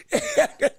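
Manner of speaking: a high burst of laughter; high-pitched laugh. Real, not acted. Host cracking up to his live stream audience, high-pitched laugh. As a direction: let the laugh squeak up high. Laughing about a real possibility.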